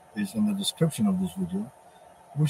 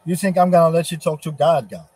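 scornful shout; 5 semitones higher, 7 dB louder than usual. Acted. God voiced scoffing at the devil.

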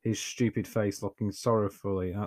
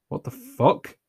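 shocked; 5 semitones higher, 6 dB louder, slower than usual. A narrator's reading. Anya shocked by ghost hand.

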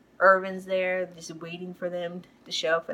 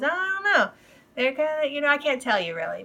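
high-pitched evasive reply, acted; Irvin; 7 semitones higher, faster than usual. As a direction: lightly mimic someone dodging a question. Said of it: "He goes".

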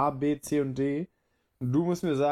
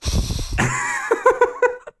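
high burst of laughter; laughing; 7 dB louder than usual. Real, not acted. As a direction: laugh out loud, amused. Laughs at guessing question.

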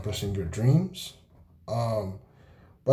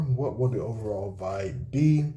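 ordinary talk

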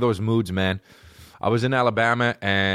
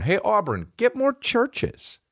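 drawn-out, much higher plea; mock plea; 12 semitones higher, much slower than usual. Comic calling out to Auburn, slow and pleading. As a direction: stretch it out like begging a town.

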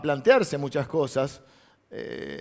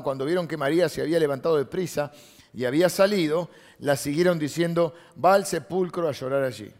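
loud, raised Bible reading; emphatic scripture reading; 2 semitones higher, 5 dB louder than usual. Acted. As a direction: proclaim the verse to the congregation.

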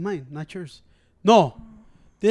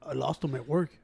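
meek Ciro voice; 9 semitones lower, 11 dB quieter than usual. Retold or imitated. Imitating Ciro answering about keys.

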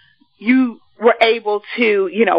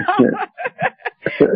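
high giggle; 10 semitones higher than usual. Real, not acted. Host laughing at own nickname.

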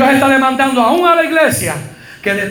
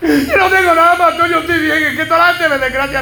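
imitating a tearful woman, higher; mock crying; 6 semitones higher than usual. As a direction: play her half-laughing, half-sobbing denial. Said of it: "Mira, está llorando".

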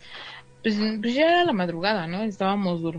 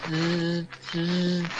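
low buzzing hum of a vibrating phone; imitated phone buzzing; 3 semitones lower, 4 dB quieter than usual. Telling a friend about the ghost phone, imitated phone buzz. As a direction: buzz like a cellphone on a table. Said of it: "el vibrar de un celular".

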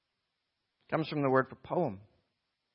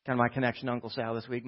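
ordinary delivery

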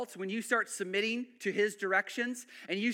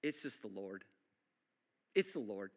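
soft, humble "It's the Lord"; quiet awe; 13 semitones lower, 8 dB quieter than usual. Retold or imitated. Believer humbly crediting God for changed life. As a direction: say it almost under your breath. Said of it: "You'll start going".

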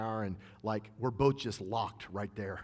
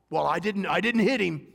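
higher, louder smug self-defence; mock defensive believer; 7 semitones higher, 9 dB louder than usual. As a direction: play the self-righteous churchgoer, higher and louder. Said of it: "I've heard people talk like that".

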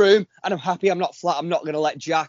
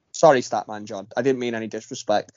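normal talking voice

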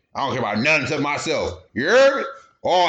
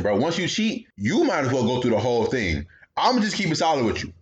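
ordinary talk, nothing marked

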